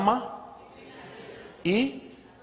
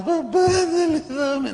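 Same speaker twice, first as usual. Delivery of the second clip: put-on higher, whining little kid begging; whiny child; 11 semitones higher, slower than usual. Preacher imitating a child begging his father through tears, in a higher whine. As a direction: beg like a crying child wanting something. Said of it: "no me pidas con lágrimas".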